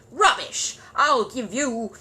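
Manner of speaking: loud spluttering rage. Acted. Curry to Gruber, loud spluttering rage. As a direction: splutter then break off. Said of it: "spluttered Mister Curry".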